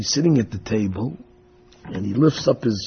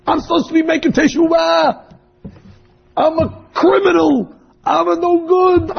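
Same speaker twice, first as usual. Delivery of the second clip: high, loud anguished self-reproach; retold screaming; 14 semitones higher, 3 dB louder than usual. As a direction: cry out at yourself in guilt, much higher and louder. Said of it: "And he starts screaming".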